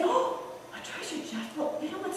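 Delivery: soft, breathy hush; hushed wonder. Acted. Tree whispers about treasure.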